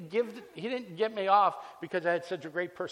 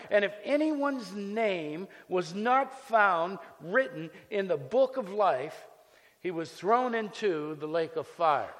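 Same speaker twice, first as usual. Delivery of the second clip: scripture reading; 3 semitones higher, 5 dB louder than usual. Acted. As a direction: read it like doom is coming.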